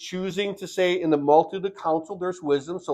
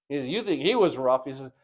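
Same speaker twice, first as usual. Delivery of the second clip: ordinary talk, nothing marked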